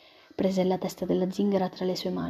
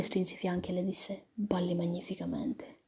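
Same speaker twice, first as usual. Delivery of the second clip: leise, tonlos geflüstert; whisper; 8 dB quieter than usual. A narrator's reading. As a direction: hauch die Worte ganz ohne Stimme. Intimate dance scene, whispered.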